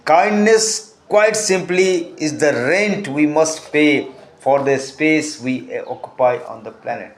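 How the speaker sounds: reading an English quote, very slow and deliberate. Acted. Teacher reading a quote to students, slow and measured. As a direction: read each word out slowly to the class.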